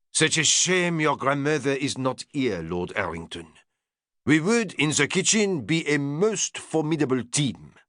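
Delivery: put-on strong French accent, proud and grand; French chef. French chef boasting his menu to Lord Errington, strong French accent.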